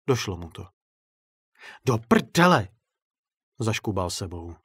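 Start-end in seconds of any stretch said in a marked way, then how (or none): 1.9-2.7 sudden loud, higher cry of alarm; startled shout; shocked curse. Acted. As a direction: blurt it out as the trap closes. Trapped director swears in shock.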